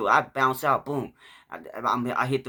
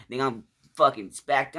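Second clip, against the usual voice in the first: ordinary talk, nothing marked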